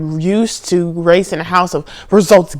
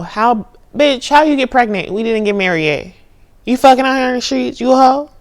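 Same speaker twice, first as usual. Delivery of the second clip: higher, louder, a mock outraged rant; mock scolding; 6 semitones higher, 4 dB louder than usual. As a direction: scold like a judgmental auntie. Voicing judgment of pregnancy.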